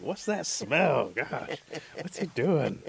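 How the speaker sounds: loud, high, drawn-out complaint at a stink; appalled onlooker, stretching the words; disgusted. Acted. Villagers recoil from his stink.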